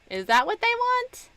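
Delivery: high, rising disbelief; incredulous. Real, not acted. Stuck on a puzzle, asking in high disbelief. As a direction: squeak the question up high.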